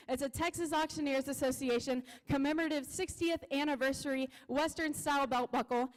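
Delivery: ordinary talk, nothing marked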